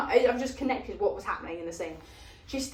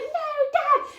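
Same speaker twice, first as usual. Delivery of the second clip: much higher desperate cry; mock frantic plea; pleading daughter. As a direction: call out to dad as he locks the window. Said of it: "She's like".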